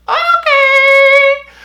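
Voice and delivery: very high, loud, stretched squeal; mock-panicked high squeal; squealed reluctant okay. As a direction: squeak out a long exaggerated okay. Squeaked reply to mom's order.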